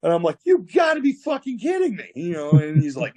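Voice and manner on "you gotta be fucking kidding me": very high-pitched disbelieving protest; retold incredulity. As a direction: squeal it in mock outrage. Incredulous reply to threat.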